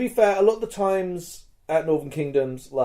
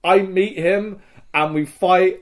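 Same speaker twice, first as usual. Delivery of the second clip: normal talking voice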